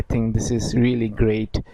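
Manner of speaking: slow English example. Shown as a demonstration. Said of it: "ইংলিশে হয়তো এটিকে আরেকভাবে বলতে পারেন".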